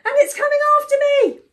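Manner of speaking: high, loud, frantic bird; fast, high storybook panic; panicked cry. A narrator's reading. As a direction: gabble in shrieking alarm. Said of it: "cried Warbler".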